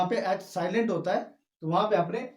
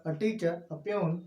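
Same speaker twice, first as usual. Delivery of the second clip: slow dictation; 4 dB quieter, much slower than usual. Shown as a demonstration. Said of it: "dictating examples".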